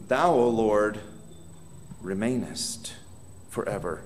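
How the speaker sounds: soft, low, reverent reading of the verse; hushed scripture reading. Acted. Preacher reading the promise to his church, softly. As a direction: let the words sink almost to a murmur.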